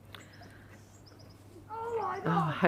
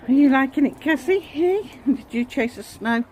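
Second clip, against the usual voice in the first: talking to the dog; 8 semitones higher, 3 dB louder than usual. Real, not acted.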